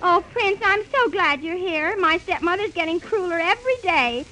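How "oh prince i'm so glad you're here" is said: ordinary delivery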